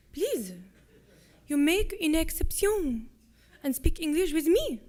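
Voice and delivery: put-on loud, swooping French-accented English; thick French accent. A narrator's reading. Soldier pleading to practice English with Louisa, thick French accent. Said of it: "With an accent so thick it was nearly impossible to make out his words".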